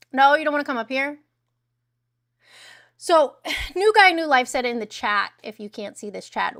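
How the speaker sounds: ordinary delivery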